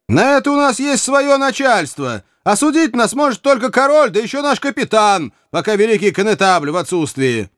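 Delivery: high, emphatic protest; defiant exclamation. A narrator's reading. Archer telling the provost who commands them, defiant. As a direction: assert it loftily, pitch held up high. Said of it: "воскликнул Каннингем".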